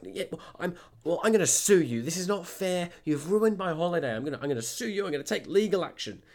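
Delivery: high, worked-up imitation of an upset customer; emotional, panting customer. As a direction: mimic her agitated threats. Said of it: "getting a bit emotional, sort of, like, panting".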